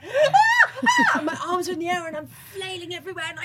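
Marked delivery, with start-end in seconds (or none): 0.0-1.2 high, loud laughing yelps; excited cries. Retold or imitated. Waving at the rescuer, high excited yelps. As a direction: squeal with relief. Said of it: "I'm going".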